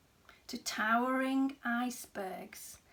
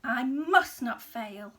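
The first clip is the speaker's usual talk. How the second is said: louder, resolute vow; determined; 7 dB louder than usual. A narrator's reading. Snail vowing to save the whale, determined. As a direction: set your jaw and promise. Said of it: "said the tiny snail".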